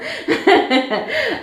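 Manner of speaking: a bright, high burst of laughter; short high laugh; high giggle. Real, not acted. Laughing after thanking counselor.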